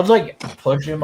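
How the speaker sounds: usual speaking voice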